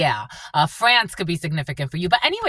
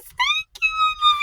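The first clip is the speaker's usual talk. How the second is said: very high, squeaky thank-you; delighted squeal. Real, not acted. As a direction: squeal it at the top of your pitch. Same thanks repeated as squeal.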